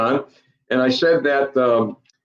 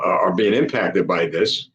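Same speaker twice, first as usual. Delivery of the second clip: usual speaking voice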